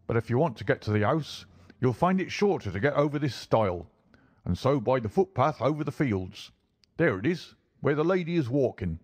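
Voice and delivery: a local driver answering a gentleman. Acted. Driver gives directions in character.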